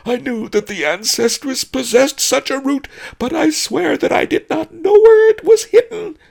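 high, loud, desperate pleading; terrified howling. A narrator's reading. Pawnbroker swearing innocence to a vengeful spirit, panicked howl. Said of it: "howled Pawnbroker Fang".

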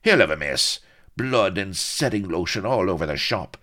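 bad-tempered account. A narrator's reading. Blood reporting the siege to Avery, bad-tempered. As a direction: rattle out the sordid tale crossly. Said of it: "seemed to be in a bad temper".

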